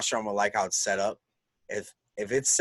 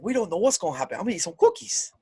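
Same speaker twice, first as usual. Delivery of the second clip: high, put-on British accent; a bad imitation of the wrestler's British voice; mocking high British impression; horrible Drake Maverick accent; 10 semitones higher, faster than usual. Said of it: "That's a horrible Drake Maverick accent".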